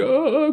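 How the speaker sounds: high, squeezed prayer groan; imitated groan of exaggerated devotion; mock straining moan. Preacher shows praying with clenched fists, mock straining moan. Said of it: "con una voz especial".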